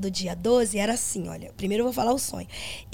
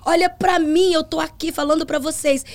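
slow, louder call to the congregation; retold sermon, slow and louder; preaching; 4 semitones higher, 5 dB louder than usual. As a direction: lift your voice to the church. Retells addressing congregation.

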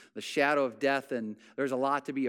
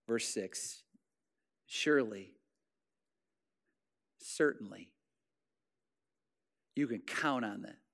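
soft closing preaching; 3 semitones lower, 5 dB quieter than usual. Acted. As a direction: ease the room down and let each phrase land. Soft closing reading of psalm.

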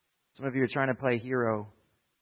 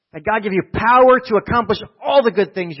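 prayer preached high and loud; full-voiced pulpit repetition; loud preaching; 9 semitones higher, 11 dB louder than usual. Real, not acted. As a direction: lift the blessing up with force. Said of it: "repeats the prayer louder".

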